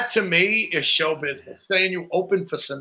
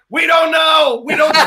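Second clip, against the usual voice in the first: retold deli guys shouting their answer, raised and high; mock shout; 9 semitones higher, 5 dB louder than usual. Deli workers shouting they don't know the price, loud and high. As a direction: bellow it like the clueless deli guys.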